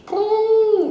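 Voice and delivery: high, loud squeal of alarm; shocked ooh. Acted. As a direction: recoil at the thought. Cap recoils at chili sauce.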